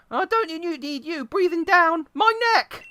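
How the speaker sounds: high, slowed bratty protest; annoyed teen girl. Pretend. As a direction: push him away up high. Voicing the daughter, high timbre.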